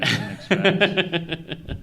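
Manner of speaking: breaking into a high, slow laugh mid-sentence. Real, not acted. Host laughing at a weird heartfelt game, laughing while talking. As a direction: laugh the words out, caught off guard. Laughing through surprised remark.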